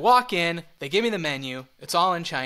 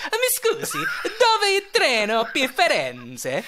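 exaggerated Italian accent; 8 semitones higher, slower than usual. Shown as a demonstration. Tourist asking for the train to Florence, exaggerated Italian accent. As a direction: pretend to be Italian, hammed up. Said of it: "pretend you're Italian".

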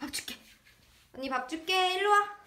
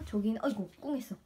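normal talking voice

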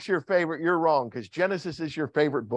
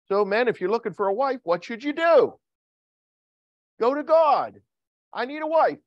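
high, loud call to action; forceful preaching; 8 semitones higher, 4 dB louder than usual. Real, not acted. Preacher urging men to go to God, high forceful exhortation. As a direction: ring it out to the whole congregation.

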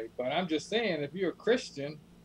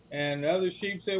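normal talking voice

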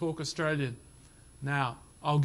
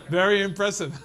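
playful praise; 4 semitones higher, 7 dB louder than usual. Real, not acted. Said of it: "you talk Australian".

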